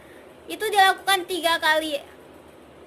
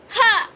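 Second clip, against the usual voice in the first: sharp, loud, higher ha on the out-breath; explosive exhale ha; short shout ha; 5 semitones higher, 6 dB louder than usual. Shown as a demonstration. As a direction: bark the breath out as ha. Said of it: "berteriak ha".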